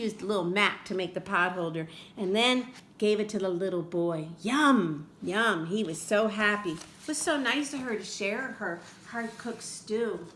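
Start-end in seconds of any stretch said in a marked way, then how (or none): none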